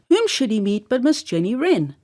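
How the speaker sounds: ordinary delivery, nothing marked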